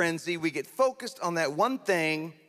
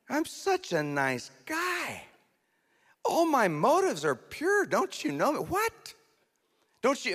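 much higher, squeaky self-righteous whine; mock indignant; 9 semitones higher than usual. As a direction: protest your own innocence like a wounded child. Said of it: "I just wanna say".

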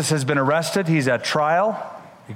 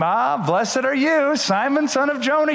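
mock blessing; 9 semitones higher than usual. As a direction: play the praise Peter hoped for, overdone. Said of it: "Peter expected Jesus to say a second time".

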